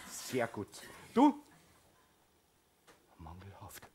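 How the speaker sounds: low, soft disappointed mother; a mother judging her son; cold grade reading. Acted. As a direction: read out the bad grade.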